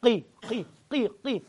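repeated قي from the back of the throat; تكرار القاف من الحلق; throat qaf drill. Shown as a demonstration. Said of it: "قيم جاي من أقصى الحلق".

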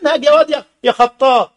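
ordinary talk

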